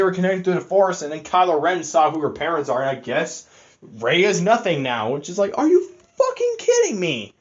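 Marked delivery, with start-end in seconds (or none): 5.6-7.3 much higher, incredulous rant; fed-up fan blurting out disbelief; exasperated outburst. Real, not acted. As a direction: throw it up high in disbelief. Ranting about the movie twist.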